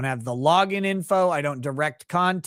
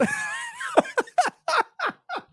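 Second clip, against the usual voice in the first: a long squeal of laughter, much higher; can't hold the laugh in. Real, not acted. Laughs at the kid's answer.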